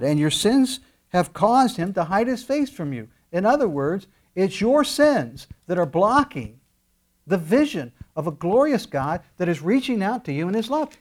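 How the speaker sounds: loud, high-pitched preaching voice; impassioned preaching. Real, not acted. Preacher warning listeners that sins block God, impassioned preaching.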